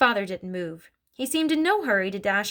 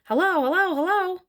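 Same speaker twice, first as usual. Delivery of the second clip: high, loud parrot call; 10 semitones higher, 4 dB louder than usual. A narrator's reading. Bird calls down the chimney to Matilda, parrot voice. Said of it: "the bird called down to her".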